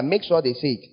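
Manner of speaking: normal talking voice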